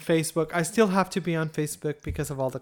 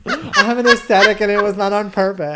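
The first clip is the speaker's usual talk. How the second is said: louder, laughing it out; 4 semitones higher, 9 dB louder than usual. Real, not acted. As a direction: laugh as you admit it. Laughs at herself.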